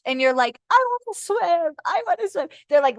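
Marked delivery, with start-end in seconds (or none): 0.7-2.5 high, put-on whiny voice; mock childlike pleading. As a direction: mimic someone pleading, pitched way up. Voicing the reluctant listener.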